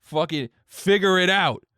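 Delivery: hammed-up bar argument; upset customer. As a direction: imitate a belligerent drunk telling someone off. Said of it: "he gets more upset".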